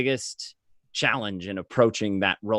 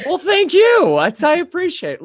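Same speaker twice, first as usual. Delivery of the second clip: high, loud, laughing thanks; host thanking a guest for the beard compliment; flattered laugh; 10 dB louder, slower than usual. Real, not acted. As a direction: gush back through a laugh.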